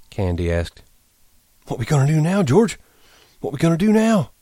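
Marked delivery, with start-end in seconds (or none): 1.7-4.3 put-on high-pitched, helpless old ranch hand; pleading old man. A narrator's reading. Old hand asks George what now, high and pleading. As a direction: plead in a much higher old man's voice.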